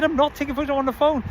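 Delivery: high-pitched, insistent protest; exasperated denial. Retold or imitated. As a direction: act out being wrongly accused, voice right up. Retold repeated denial in quarrel.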